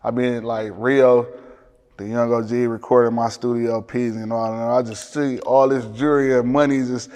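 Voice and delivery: ordinary talk, nothing marked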